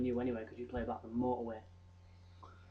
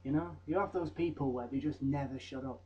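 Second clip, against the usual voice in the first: usual speaking voice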